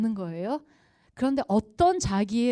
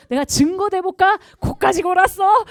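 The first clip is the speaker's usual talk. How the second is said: triumphant proof; 10 semitones higher, 12 dB louder than usual. Retold or imitated. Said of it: "뭐냐면 좋은 의도".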